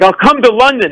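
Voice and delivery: high nervous blurt; nervous weird voice. Retold or imitated. Flustered fan blurting his offer to [name] in a high voice. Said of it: "suddenly started to make this voice".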